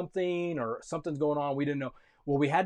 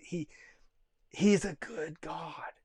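usual speaking voice

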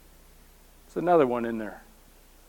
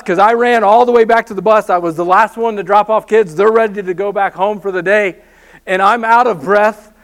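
ordinary talk

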